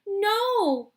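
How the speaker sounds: high loud shout. Acted. Charlie shouts at Wonka, loud. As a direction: cry out to stop it. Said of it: "shouted Charlie".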